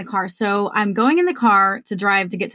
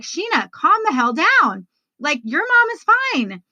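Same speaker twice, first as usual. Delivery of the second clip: high-pitched, drawn-out telling-off of oneself; self-scolding; 8 semitones higher than usual. Retold or imitated. Woman kicking her own butt mid-panic, scolding herself. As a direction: order yourself to calm down, slow and emphatic. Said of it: "I start kicking my own butt because I'm like".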